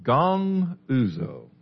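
"Gong uzo" said very slowly, syllable by syllable; Greek pronunciation. Shown as a demonstration. Pastor teaching the class the Greek word, slowly spelled out in sound. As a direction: draw out "Gong uzo" so "gong" rings clear. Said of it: "you'll hear the English word in the Greek word".

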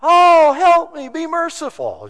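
high, loud hammed-up cry for help; mock shouting. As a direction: imitate a woman wailing out for mercy. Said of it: "running around shouting".

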